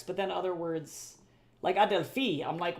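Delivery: ordinary delivery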